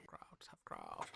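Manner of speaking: low, slow whispered comedian aside; whispered tough crowd. Pretend. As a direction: whisper it like a dying stand-up. Comedian's joke fell flat.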